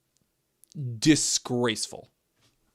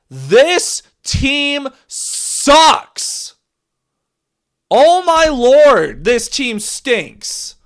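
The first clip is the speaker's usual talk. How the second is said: very slow, high, loud dragged-out complaint; disgusted wail; 11 semitones higher, 10 dB louder, much slower than usual. Real, not acted. Lions fan venting at his own team, loud drawn-out wail. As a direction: moan it out like your team just broke you.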